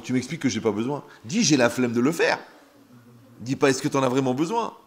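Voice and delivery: loud, insistent reproach; exasperated scolding. Acted. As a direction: tell off an excuse-maker at full voice. Scolds an imagined excuse-maker. (reproche exaspéré, à voix forte)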